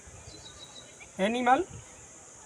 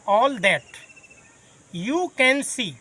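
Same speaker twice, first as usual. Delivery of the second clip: drawn out slowly, each word separate; slow dictation; much slower than usual. Shown as a demonstration. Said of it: "teacher dictating definition". (धीमे-धीमे लिखवाना)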